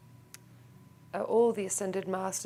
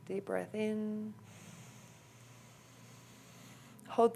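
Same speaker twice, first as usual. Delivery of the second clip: calm, softer meditation voice with long pauses; guided meditation; 6 dB quieter than usual. Real, not acted. Teacher guiding the group into a clearing breath, calm meditation voice.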